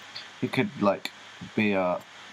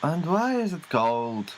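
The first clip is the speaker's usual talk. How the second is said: high, slow fussy complaint; spoiled star griping; diva complaint; 7 semitones higher than usual. Pretend. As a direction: whine it like a pampered star. Playing diva.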